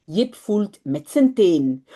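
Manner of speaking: ordinary talk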